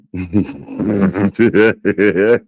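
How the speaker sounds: loud, rolling laugh; loud cackle. Real, not acted. Amused at what she is hearing, laughing loudly.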